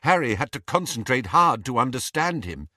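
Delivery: plain reading voice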